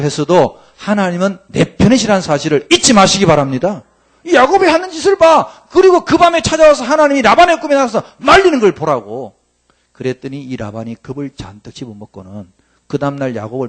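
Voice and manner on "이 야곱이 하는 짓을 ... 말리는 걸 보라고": much higher and louder, pressing the point; fiery preaching. Real, not acted. Pastor urging the congregation to look, full-force preaching. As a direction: preach it hard to the pews.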